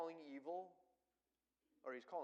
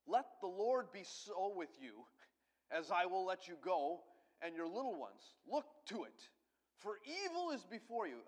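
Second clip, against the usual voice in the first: loud, higher, slow royal warning; Pharaoh speaking; 5 semitones higher, 9 dB louder than usual. Acted. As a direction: speak down to Moses as the king. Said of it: "this is Pharaoh speaking".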